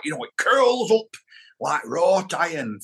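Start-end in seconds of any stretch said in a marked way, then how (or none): 0.0-1.1 curling Lancashire tune. Shown as a demonstration. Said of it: "Lancashire sort of goes like that".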